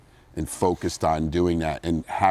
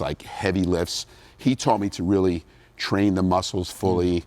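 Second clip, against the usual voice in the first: ordinary talk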